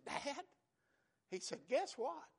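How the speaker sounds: high-pitched, eager phone announcement; excited son. Retold or imitated. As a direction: voice the son bursting with news. Son phoning with baby news.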